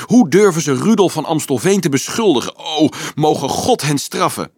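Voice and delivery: high, loud, passionate protest; wild outrage. A narrator's reading. Marieke defends the accused Rudolf to the priest, wildly outraged. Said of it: "riep ze wild".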